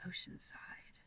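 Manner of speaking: a soft whisper, thinking; whispered to herself. Real, not acted. Still trying to remember the place, whispering. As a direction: breathe the name to yourself. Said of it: "I'm trying to remember".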